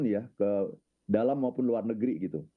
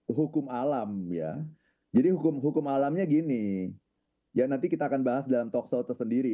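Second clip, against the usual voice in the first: ordinary talk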